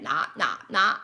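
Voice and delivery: low smacking nah sounds; open-mouth chewing sound. Shown as a demonstration. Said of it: "Uh, mouth open".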